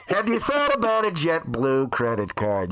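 salesy in-flight pitch, ending slow; hammed-up announcer selling a credit card; mock ad read. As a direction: parody of an airline credit card promo.

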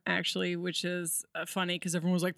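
usual speaking voice

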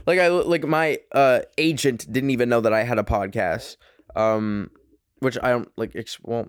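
ordinary talk, nothing marked

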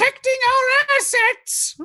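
put-on Monty Python old lady. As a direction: screech it like a Python pepperpot. Player in Fluffy's screeching voice.

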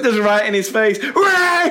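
very high mock whoop; hammed-up jeering cry; in-your-face cheer. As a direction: shout it out high through laughter. Mimicking the cheer in someone's face.

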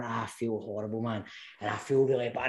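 low, hushed, sorry-for-himself voice; hungover dread. Retold or imitated. Beaten-up man waking with the fear, low subdued misery. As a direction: imitate a subdued morning-after moan. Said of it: "I've got a fear".

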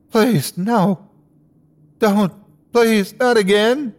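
high, anguished begging; distraught pleading. A narrator's reading. Boy sees his parents crushed, high anguished pleas. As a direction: plead high, in rising hysteria. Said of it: "reverted to intense hysteria".

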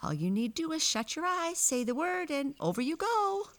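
very high, loud little-man voice; high-pitched gleeful little fellow; tiny man. A narrator's reading. Same tiny man keeps talking.

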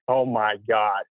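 low, stunned mock reaction; retold dismay. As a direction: drop low, imitating an aghast dad. Retold father's shock at cliff.

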